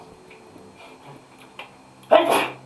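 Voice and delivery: sudden loud sneeze. Real, not acted. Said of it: "Bless you".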